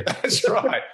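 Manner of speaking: laughing. Real, not acted. Laughing agreement on Australia myths.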